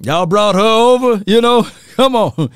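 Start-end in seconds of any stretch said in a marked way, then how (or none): none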